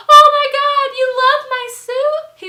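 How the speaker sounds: very high, loud mock delight; overjoyed squeal. Birthday host reading a compliment on his suit, squealing. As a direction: imitate a host thrilled to be flattered.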